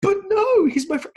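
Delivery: loud, very high tearful protest; imitated boy's desperate plea; mock crying child. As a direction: wail it like a heartbroken kid. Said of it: "he just starts crying".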